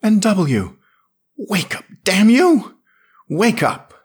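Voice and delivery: high, loud, desperate shouting; urgent shout. A narrator's reading. Yarrell shouting his unconscious friend awake, high and loud. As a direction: yell at him to wake up. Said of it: "Yarrell's voice was saying".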